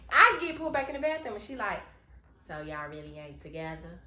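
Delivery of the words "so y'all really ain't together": low, soft imitation of a drunk woman. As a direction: mimic a drunk come-on, pitched down and quiet. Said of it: "that's how she sound. She was drunk as fuck".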